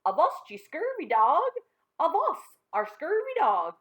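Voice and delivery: high, loud pirate toy bellow; talking pirate toy bellowing from the floor. Acted. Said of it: "that bellowed".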